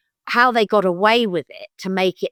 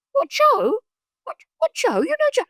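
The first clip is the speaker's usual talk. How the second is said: very high, incredulous stammered question; imitating men who cannot believe it; astonished. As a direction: repeat it in squeaky disbelief. Retold men astonished at the connection.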